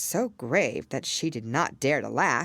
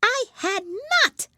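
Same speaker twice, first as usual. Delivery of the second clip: much higher, louder, sharp mouse retort; angry mouse; 7 dB louder than usual. A narrator's reading. Mouse angrily denies to Alice, high sharp cry. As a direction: snap it back like an offended mouse. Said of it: "cried the mouse sharply and very angrily".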